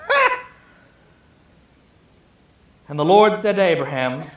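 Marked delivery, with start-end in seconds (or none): 0.1-0.4 a very high scoffing "Ha!"; incredulous laugh. Acted. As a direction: laugh off the idea. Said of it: "is as incredulousness".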